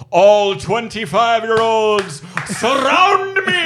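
slow, high, loud grand decree; mock proclamation. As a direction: proclaim it like a king, slowly. Mock proclamation of his wishes.

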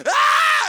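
a short, piercing shriek, very high and loud; startled full-throat scream. Retold or imitated. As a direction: scream in terror at the temptation. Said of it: "I literally screamed out loud".

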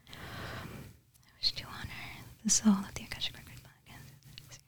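whispered prayer, dying away to almost nothing; prayer fading inward. Real, not acted. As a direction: trail off into a barely audible private murmur. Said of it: "start just saying it in my mind".

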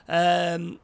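usual speaking voice

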